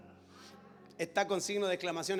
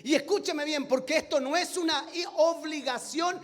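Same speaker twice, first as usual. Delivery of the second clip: raised, emphatic pulpit voice; impassioned preaching; 6 semitones higher, 6 dB louder than usual. Acted. Preacher urging his congregation, emphatic and raised. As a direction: drive the point home to the church.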